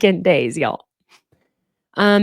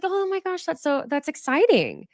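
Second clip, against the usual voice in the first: much higher, gushing excitement; mock gushing; 10 semitones higher than usual. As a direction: squeal it like a thrilled friend. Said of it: "Instead of being like".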